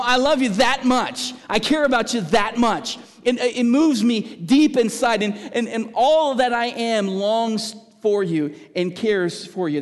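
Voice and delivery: fervent sermon climax, rising and loud; impassioned preaching. Acted. Preacher voicing fervent love.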